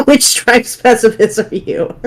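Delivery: high, slow question through laughter; laughing. Real, not acted. Joking with friends over a silly list, laughing. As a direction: ask it while still giggling.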